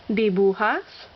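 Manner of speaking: dibujas with BU stressed; stress on second-to-last syllable. Shown as a demonstration. Teacher demonstrating a Spanish rule, stress on second-to-last syllable. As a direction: lean on the middle syllable of dibujas. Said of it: "accent on the second to last syllable".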